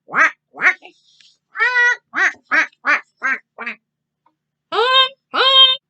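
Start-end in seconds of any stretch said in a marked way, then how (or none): 1.5-3.8 loud high quacks; a flurry of loud quacks; duck quacking. Acted. Ducks quack at traffic, loud and high. 4.7-5.8 loud high honking; car honks. Acted. Cars honk at the ducks, loud and high.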